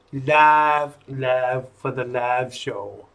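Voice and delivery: put-on southern US accent, low and slow; playful low southern accent on a drawn-out "live". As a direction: slip into a slow southern twang. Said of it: "go into this southern accent".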